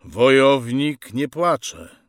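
slow, emphatic fatherly reproof; stern admonishment. Acted. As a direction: firmly lecture your son, drawing it out. Father admonishes his crying son.

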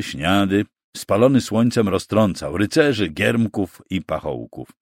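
ordinary delivery

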